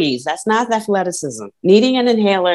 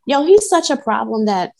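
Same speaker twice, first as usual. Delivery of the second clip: ordinary talk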